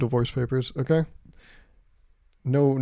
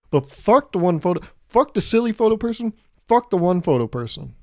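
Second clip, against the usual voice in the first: loud, pitched-up rant at the one photo person; angry rant; 5 semitones higher, 4 dB louder than usual. Real, not acted. Ranting to viewers about phone-photo people, loud and fed up.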